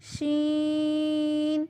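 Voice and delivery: شین gently started, long level vowel; soft sheen. Shown as a demonstration. Teacher repeating the letter unhardened, soft long شین. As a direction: keep the sh of شین easy and draw it out. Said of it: "شین کو سخت نہیں کرنا".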